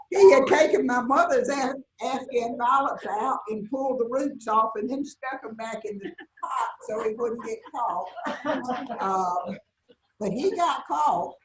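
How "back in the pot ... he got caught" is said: laughing. Real, not acted. Laughing through monkey story.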